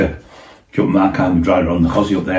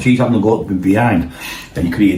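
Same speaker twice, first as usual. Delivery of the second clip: usual speaking voice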